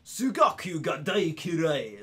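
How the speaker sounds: slow, drawn-out Japanese with a hum; hammed-up Japanese delivery, stretched vowels; Japanese impression. Streamer tries out the bit of Japanese they know, exaggerated Japanese accent. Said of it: "same with Japanese".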